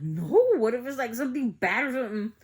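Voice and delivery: loud, high-pitched retold alarm; acted-out nervous objection; worried protest. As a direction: blurt it out loudly as if dreading the answer. Retold worried protest to her brother.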